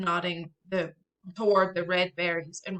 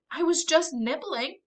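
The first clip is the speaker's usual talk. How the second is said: grumbling; 8 semitones higher than usual. Acted. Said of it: "he grumbles".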